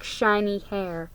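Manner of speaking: plain reading voice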